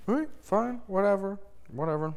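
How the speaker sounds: high-pitched dismissive imitation; playfully whiny concession; mock huffy. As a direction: give in like a petulant loser, pitch sinking. Retold officers grudgingly giving up.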